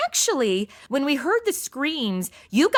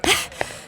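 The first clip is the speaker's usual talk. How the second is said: gasp; 3 dB quieter than usual. Acted. Madison hears of the death.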